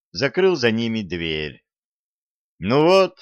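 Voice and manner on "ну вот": bright upbeat exclamation; cheerful Russian "ну вот". A narrator's reading. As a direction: say it pleased with yourself. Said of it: "весело сказал он".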